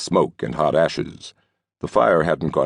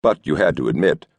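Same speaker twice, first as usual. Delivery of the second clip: ordinary delivery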